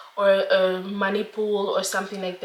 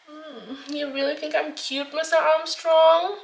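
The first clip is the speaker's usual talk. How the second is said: coy flirty voice; 8 semitones higher, slower than usual. Pretend. Coy reply to imagined flatterer.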